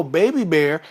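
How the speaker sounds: ordinary delivery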